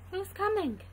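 ordinary delivery, nothing marked

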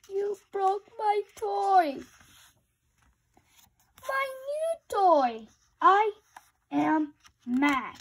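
upset outburst. Acted. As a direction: wail over the broken toy. Friend breaks elephant's new toy.

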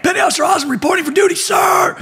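very high, rapid-fire recruit yell; screamed military report. Retold or imitated. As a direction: report in with over-motivated Marine zeal, ending high on "sir". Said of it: "screaming the fucking top of my lungs".

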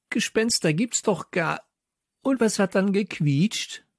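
high-pitched, exclaimed protest and question; called out. A narrator's reading. The child narrator challenges friends about a ghost, calling out high. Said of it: "rief ich".

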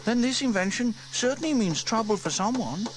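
put-on high, bright-eyed girl wondering aloud; young girl Sarah, curious. A narrator's reading.

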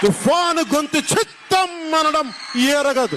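slow, shouted proclamation; shouted rally declamation. Real, not acted. Politician defying rivals with a storm line, shouted declamation. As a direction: shout it out slowly to a crowd.

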